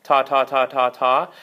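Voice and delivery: short crisp repeated 'ta, ta, ta' as a tongued articulation; tonguing syllable. Shown as a demonstration. Oboe teacher demonstrating reed articulation to students, crisp 'ta' syllables. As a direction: tap out 'ta' each time like a tongued note on the reed. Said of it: "like I'm saying the syllable ta".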